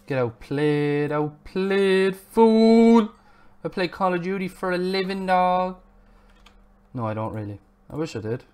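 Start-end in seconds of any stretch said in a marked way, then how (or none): 0.1-5.8 hammed-up trash talk building louder and higher; mock gamer boast. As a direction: crow like a pretend pro gamer, peaking on "fool". Mock boast, then admits it's untrue.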